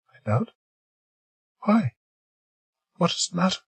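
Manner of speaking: a hint of Margaret, worried; lightly voiced woman.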